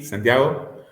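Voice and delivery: ordinary talk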